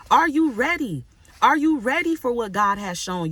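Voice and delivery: Are you ready? thrown high and loud, stress on you. Shown as a demonstration. Preacher challenging the congregation, rising and loud. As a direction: press the question home, punch you. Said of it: "really ask yourself that".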